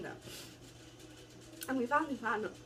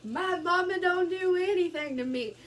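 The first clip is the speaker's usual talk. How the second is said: high, loud taunting voice; mocking city kids; 6 semitones higher, 4 dB louder than usual. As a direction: taunt like a smug kid, much higher. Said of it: "y'all city kids are sitting like".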